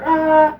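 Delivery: short high grunting yell; mock straining yell. Mimicking Trinity straining in the trailer, high yell. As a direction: heave it out like lifting hard.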